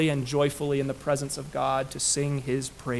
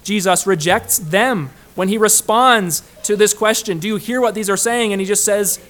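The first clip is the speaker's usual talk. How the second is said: loud, raised pulpit voice; forceful preaching; 6 semitones higher, 9 dB louder than usual. Real, not acted. Preacher setting up Jesus' reply to rulers, forceful preaching. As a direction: build emphatically toward the answer.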